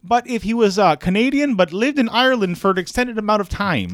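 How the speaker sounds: high mock Peterson voice; a pinched, Kermit-like professor. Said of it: "I'm getting a little Irish now".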